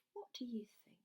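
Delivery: whisper. Real, not acted. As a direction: hush it while the cymbal rings. Teacher hushes over instrument sounds.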